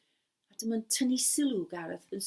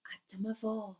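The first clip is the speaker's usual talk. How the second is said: plain reading voice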